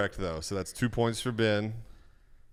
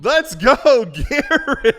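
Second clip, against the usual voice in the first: laughing cheer; 13 semitones higher, 13 dB louder, much slower than usual. Real, not acted. Host cheers player's correct answer.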